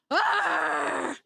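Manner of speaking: mock yelp. Retold being body-slammed.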